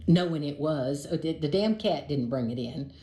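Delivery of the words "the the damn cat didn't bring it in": plain reading voice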